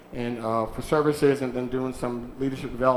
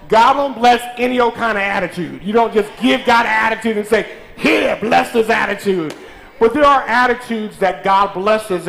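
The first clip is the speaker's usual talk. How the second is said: loud, high preacher's call to the congregation; full-force preaching; 5 semitones higher, 6 dB louder than usual. Acted. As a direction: preach it out, lifting the church.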